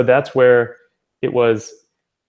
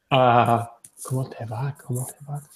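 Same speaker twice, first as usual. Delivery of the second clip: much softer, puzzled rising "¿cómo te va?"; bewildered repeat; 4 dB quieter than usual. Retold or imitated. Stunned student repeats the unknown greeting, soft and puzzled. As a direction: mumble it back, baffled. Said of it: "they're like".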